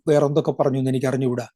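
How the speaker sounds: ordinary talk, nothing marked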